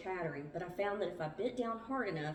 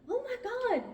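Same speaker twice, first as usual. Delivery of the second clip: high, loud cry of alarm; 5 dB louder than usual. A narrator's reading. Meg struck in the face by a bird, crying out. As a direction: cry out in sudden shock. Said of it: "Meg cried".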